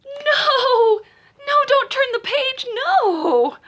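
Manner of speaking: high, loud frantic no with a laugh in it; hammed-up panic begging the reader to stop; panicked shout. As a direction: yell to stop the page from turning. Narrator begs child not to turn.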